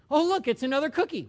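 bright, excited gasp at the cookie; mock delight. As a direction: play the thrilled cookie spotter.

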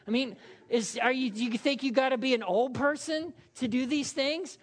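ordinary talk

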